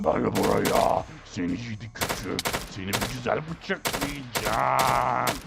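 very slow, low villain voice; menacing villain. Acted. Knife killer threatens the player, slow menacing villain.

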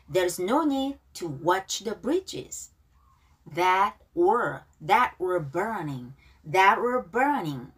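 very slow, each word clear; teacher modelling a lyric line slowly; slow repeat after me. Shown as a demonstration. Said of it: "자, 발음 따라하기". (천천히 또박또박)

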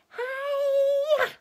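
very high, loud, drawn-out yell; karate cry. A narrator's reading. Miss Piggy karate chopping Kermit, shrieked battle cry. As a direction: let out a long squealing battle cry.

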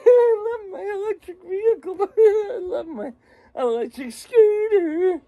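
mock them while cracking up; laughing mockery. Mocking electric scooter owners, laughing high voice.

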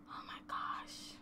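breathy unvoiced whisper, very soft. Real, not acted. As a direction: gasp it out in a whisper. Whispered reaction while playing.

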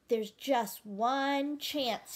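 high, slow hopeful thought; slow, thoughtful. Acted. Ralph thinking of a plan, slow and hopeful. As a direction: think it through slowly. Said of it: "he thought".

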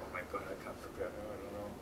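seeing the cramped kitchen, quick soft muttered panic. Retold or imitated. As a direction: mutter it to yourself in a rush. Panicking at tiny kitchen space.

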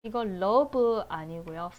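usual speaking voice

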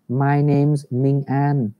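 slow, even teacher model; each word laid out slowly; slow model reading. Shown as a demonstration. Teacher models the answer slowly for the class, slow and clear. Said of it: "thầy dịch lại những câu đấy".

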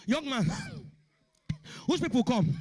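mock panting policeman; out of breath. Policeman questions runner after long chase, out of breath.

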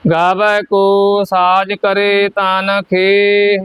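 ਖੇ sung lightly with a soft breathy end, not drawn out; ਖੇ ਪੋਲਾ ਤੇ ਛੋਟਾ; soft short ਖੇ. Shown as a demonstration. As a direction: chant ਖੇ gently and short. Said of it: "ਖੇਹ ਇਸ ਤਰ੍ਹਾਂ ਪੋਲਾ ਜਿਹਾ".

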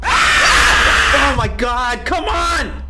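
loud, slow frightened yell; scared shout. Real, not acted. Streamer shouting as a monster lunges at him. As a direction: cry out at the sudden scare.

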